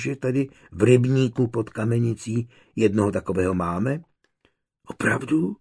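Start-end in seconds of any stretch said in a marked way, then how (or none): 4.9-5.6 breathless, wide-eyed whisper of "Opravdu?"; awed whisper. A narrator's reading. Little boy whispering in awe to his grandfather. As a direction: hush it in wonder. Boy hears of the pond's water goblin.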